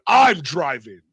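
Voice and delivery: loud, forceful mimicry of the driver; retold outburst. Driver announcing to passengers that he's driving, mimicked forcefully. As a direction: imitate him blurting it loudly. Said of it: "Not like I'm gonna drive, just like".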